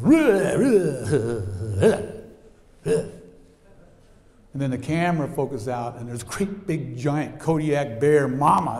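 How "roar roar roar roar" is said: fading playful roars, now safe; cocky little roar. Retold or imitated. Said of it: "the little cub bear goes".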